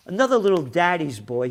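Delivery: ordinary talk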